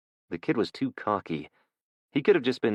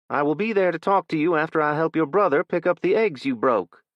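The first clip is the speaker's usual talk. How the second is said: higher, louder mother laying down the law; firm mother; 10 semitones higher, 6 dB louder than usual. Acted. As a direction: promise the talk that's coming. Mother sets consequence.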